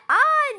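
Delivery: shrill high witch shriek; witch cackle. Retold or imitated. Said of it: "cadı gibi gülüyor". (cadı kahkahası)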